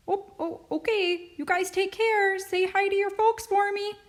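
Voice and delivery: high, carrying friendly send-off; calling after them. A narrator's reading. Neighbour calling after two children as they leave, calling out. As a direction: call out in a sustained high voice. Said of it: "she calls after them".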